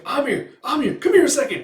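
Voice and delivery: high, loud call for attention; urgent beckoning. Retold or imitated. Beckoning her from a hiding place.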